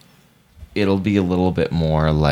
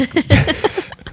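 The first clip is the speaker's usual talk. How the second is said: high burst of laughter. Real, not acted. Laughs at being teased.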